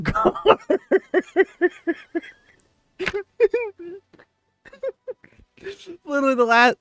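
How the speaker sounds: high, fast words through real laughter; can barely get the words out for laughing. Real, not acted. Gamer to viewers, breaking up over the clip, laughing hard.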